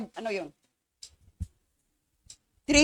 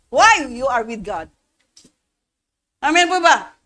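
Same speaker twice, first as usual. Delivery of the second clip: ordinary delivery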